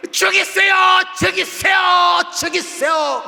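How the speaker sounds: high, loud repeated shout, calling out; yelling at God. Acted. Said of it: "막 이러는 거예요". (하나님을 향해 높고 크게 외치는 요나)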